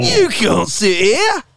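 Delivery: high, drawn-out movie quote; Forrest Gump scene. Acted. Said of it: "Forrest Gump".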